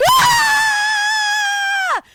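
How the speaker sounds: high, very loud piercing shriek; horror scream. Retold or imitated. Teller screams as the grandpa meets the shed monster. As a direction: scream like you just saw the monster. Monster reveal in retold story.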